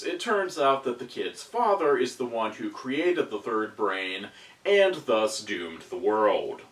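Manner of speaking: ordinary talk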